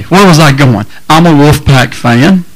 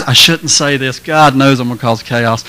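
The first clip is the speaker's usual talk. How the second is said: softer guilty aside to the crowd; quiet aside; 5 semitones lower, 7 dB quieter than usual. Real, not acted. Preacher confessing a joke to the crowd, quiet aside. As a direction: drop it low, a half-joking confession.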